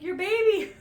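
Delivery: high, loud call to the deer; surprised call. Retold or imitated. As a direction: call after the mama deer. Calling to the deer.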